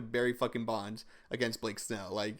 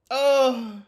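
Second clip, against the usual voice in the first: a sudden high, loud "Oh"; surprised oh; 13 semitones higher, 10 dB louder than usual. Real, not acted. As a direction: gasp it out in shock, far up. Reacting to the home run.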